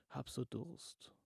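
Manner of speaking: faint, slow and parched; weak thirsty voice. Acted. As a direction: barely get the words out. Parched sick woman begging.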